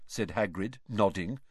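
ordinary delivery

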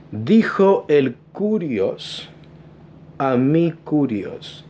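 very slow, deliberate quote of "Curios"; slow quoting. Shown as a demonstration. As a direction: spell it out slowly for the congregation. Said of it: "en la versión de los setenta dice".

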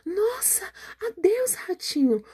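high, startled farewell; frightened goodbye. Acted. Snake trembling at the gruffalo and bidding the mouse goodbye, high and frightened. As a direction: squeak goodbye and flee. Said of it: "olhou para o grúfalo e tremeu".

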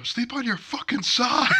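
high, loud, slow yell through laughter; yelled while cracking up; laughing shout. Retold or imitated. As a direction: bellow it across the barracks. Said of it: "you hear somebody go".

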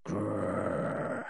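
low, soft rolled growl; dog's growl. A narrator's reading. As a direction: growl quietly like a wary little dog. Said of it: "and growl".

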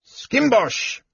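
high yelled call; yelling. A narrator's reading. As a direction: shout the name up the shaft. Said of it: "yelling back".

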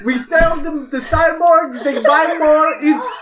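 bad Russian accent. Contestant sells cyborgs to players, hammed-up bad Russian accent. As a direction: push the sales pitch in a clumsy Russian accent. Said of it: "You have really bad accent".

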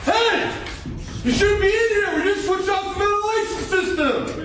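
all-out hammy shout of a warning; yelling at a ten. Acted. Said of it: "Right now you're at a ten".

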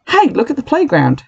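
high excited shout. A narrator's reading. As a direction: call out to the class. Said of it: "shouted Katrina".

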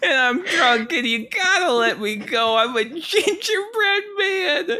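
put-on high, sobbing drunk plea; crying gingerbread man. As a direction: wail it high through laughing sobs.

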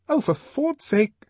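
high, loud, slow exasperation; exasperated outburst. A narrator's reading. Lenina breaking her silence at Henry, exasperated. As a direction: burst out, fed up. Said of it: "breaking her stubborn silence".

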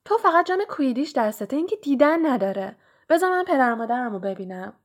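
put-on young voices tumbling over each other in excitement; excited boys at the mirror.

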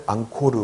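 앙코르 as "ang-ko-reu"; Korean spelling pronunciation. Shown as a demonstration. Teacher citing Korean spelling, 앙코르 read Korean-style. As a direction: say 앙코르 in full Korean syllables. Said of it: "한국말로 표기할 때는".

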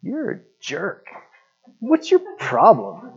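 slow, drawn-out indignant complaint; retold silent outrage, slowly spelled out; mock anger. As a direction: play at seething, stretch each word and start high. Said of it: "I'm just like, Mad".

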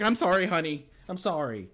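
high, loud apology; hammed-up plea for forgiveness; mock pleading. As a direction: imitate a husband begging his wife. Husband begs wife's forgiveness.

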